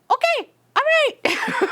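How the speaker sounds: high, squeaky startled okay; mock-caught-off-guard agreement; flustered okay. Cashier startled by angry coworker, high squeak. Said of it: "And I was like".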